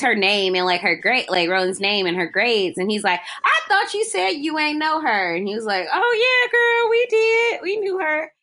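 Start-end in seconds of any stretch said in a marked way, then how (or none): 3.4-5.3 confrontation. Retold or imitated. Acted confrontation over a lie. 5.9-8.3 much higher-pitched mock admission; acted admission. Retelling someone caught out over knowing her, high mock admission.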